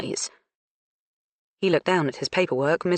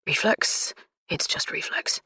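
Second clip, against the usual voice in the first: whispering; 4 semitones higher, 5 dB quieter than usual. Acted. Startled man excuses his flinch.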